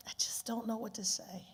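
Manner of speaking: whispered, near tears; holding back tears. Real, not acted. Speaker overwhelmed before the audience, whispering near tears. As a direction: barely get the words out, choked. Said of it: "I'm trying not to cry".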